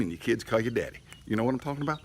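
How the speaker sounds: ordinary talk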